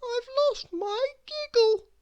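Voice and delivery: very high, small sad voice; miserable little girl. Acted. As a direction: confess it sadly like a tiny girl. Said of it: "she explained miserably".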